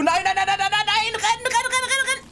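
panicked high shrieking; being chased. Real, not acted. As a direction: yell in rising panic to run. Monster coming toward them.

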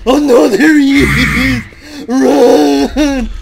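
loud, high, slow panicked wail; wailing as the monster shows up; terrified crying out. Real, not acted. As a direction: cry out in fear, then urge yourself to run. Monster appears, he flees.

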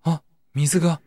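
mild startled gasp-like line; startled cry. Acted. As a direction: point out the moving water in surprise. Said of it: "ナミが声を上げた".

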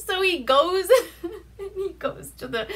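laughter spilling over the setup; laughing. Real, not acted. Recapping the movie to a friend, giggling. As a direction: still giggling through the words. Retelling, still cracking up.

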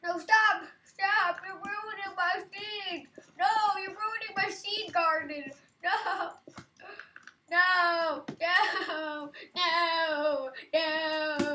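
loud high frantic protest; panicked shouting. Real, not acted. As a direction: scream at them to stop. Garden being ruined.

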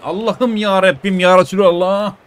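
slow, loud desperate cry to God; drawn-out frustrated prayer; loud exasperated plea. Real, not acted. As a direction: wail it to the heavens. Gamer pleading after misses.

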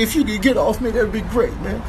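ordinary delivery

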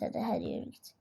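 unvoiced, hushed shooing; whispered urging. Real, not acted. Gamer whispers to the customer to hurry off. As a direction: shoo them off in a whisper.

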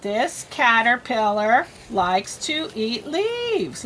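slow, word-by-word reading; spaced-out words, one at a time; slow read-aloud. Shown as a demonstration. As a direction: read slowly for a beginning reader. Models reading for a learner.

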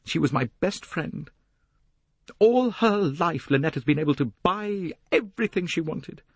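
put-on high, indignant young woman; Jacqueline, bitter. Young woman accuses her best friend of taking Simon, bitter high voice.

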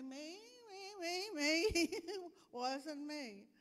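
high whiny me me me, slow laughing wasn't me; guilty kid. Acted. Child denies to mom, high and whiny. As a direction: squirm out of blame.

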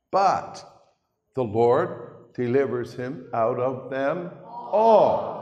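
loud, slow pulpit proclamation; preaching. Real, not acted. Preacher proclaiming deliverance to the congregation, loud and slow. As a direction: ring it out to the congregation.

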